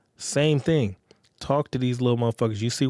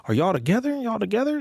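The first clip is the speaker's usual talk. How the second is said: asking if together; 9 semitones higher than usual. Retold or imitated. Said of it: "You know what I'm saying?".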